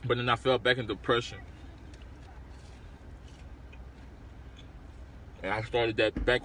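eating a snack mid-sentence; talking while chewing. Real, not acted. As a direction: mouth partly full of food. Seen 0: Hand brings rice-crispy-style treat to mouth; jaw moving between words.

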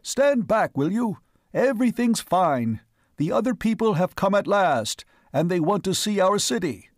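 mayor calling out to the crowd. A narrator's reading. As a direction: carry over the crowd. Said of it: "He raised his voice".

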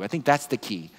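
normal talking voice

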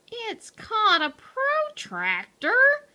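high-pitched, irritated correction; put-upon know-it-all cat; grumbling. A narrator's reading. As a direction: grumble it with a touch of scorn. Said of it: "Hector grumbled".

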